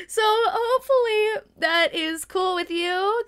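laughing. Real, not acted. Laughing at own inconsistency.

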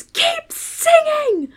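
high, drawn-out mock command; domineering choir director. Choir director whirling on the singers, domineering mock command. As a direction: order the choir on, stretched out and high. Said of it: "my director, like, whirls on them and goes".

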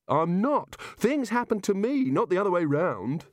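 high-pitched indignant protest. Acted. Coward arguing with kangaroo, protesting. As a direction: defend yourself, pitched well up.

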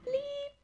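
very high, soft held bleep; high-pitched spoken "bleep"; imitated censor bleep. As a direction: mimic a censor tone, high and steady.

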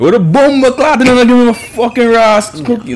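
loud, high hammed-up Jamaican accent; mock Jamaican accent. Showing friends the cookie rant, mock angry Jamaican accent. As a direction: curse them out in a Jamaican accent. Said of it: "In Jamaica".

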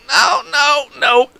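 small falsetto squeaks of no; mock pained high voice; high-pitched pained voice. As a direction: squeak out each refusal. Acts out pained reply, high.

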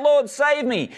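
a hint of a sinking man calling out; cried out. A narrator's reading. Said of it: "he cried out".

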